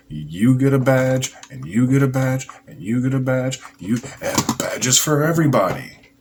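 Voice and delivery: loud, high giveaway-host announcement; mock talk-show host handing out prizes, building in excitement; Oprah. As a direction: belt it out like a TV giveaway. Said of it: "It's like Oprah".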